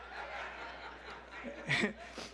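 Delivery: quiet airy chuckle at the memory; breathy unvoiced laugh. Real, not acted. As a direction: let a soft laugh slip out.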